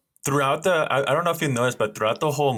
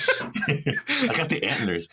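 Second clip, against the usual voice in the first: laughing slow joke voice; 5 semitones higher, much slower than usual. Pretend. Joking insect voice, laughing.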